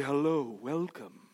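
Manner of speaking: low, very soft genteel greeting; refined shopkeeper without Southern accent. Retold or imitated. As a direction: greet softly, putting on refinement. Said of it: "how'd you lose your Southern accent".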